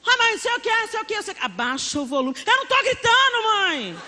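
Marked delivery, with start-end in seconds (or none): 0.1-1.4 high, whiny mock shouting; agitated child's gabble pitched high; yelling child. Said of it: "meus filhos tão gritando comigo". 2.5-4.0 high-pitched indignant mock shout; loud, whiny protest at mum; protesting yell. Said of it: "meus filhos tão gritando comigo".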